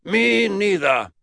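loud, drawn-out, very high; slow emphatic high declaration; Mad Uncle Jack. Acted. Great-uncle admits ignorance to Eddie, loud high voice.

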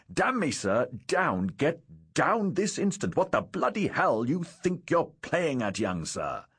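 high-pitched angry outburst; alarmed scolding. Acted. Father ordering young son down from the rigging, alarmed scolding. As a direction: yell up at a disobedient boy in alarm.

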